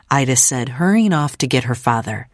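usual speaking voice

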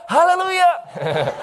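high, drawn-out shout of praise; joyful exultant holler; irrepressible cry. Retold or imitated. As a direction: let it burst out, long and high. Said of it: "I couldn't help but cry".

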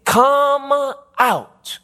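high, drawn-out heavenly call. Acted. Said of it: "I heard another voice from heaven saying".

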